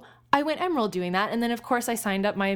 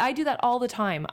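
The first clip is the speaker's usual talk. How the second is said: normal talking voice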